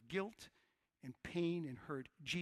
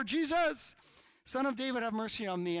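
high, full-volume shout to Jesus; yelling even louder; 7 semitones higher, 11 dB louder than usual. Acted. Blind man begging Jesus for mercy, yelling louder. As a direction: shout all the more over the crowd. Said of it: "he just starts yelling louder".

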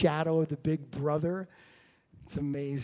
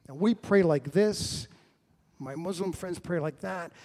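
usual speaking voice

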